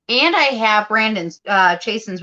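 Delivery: ordinary talk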